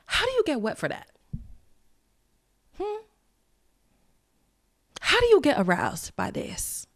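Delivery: high, soft, incredulous aside; baffled disbelief. Real, not acted. Podcaster reacting to a man's crying on the show, baffled. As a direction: ask it softly and high-pitched, with long baffled pauses.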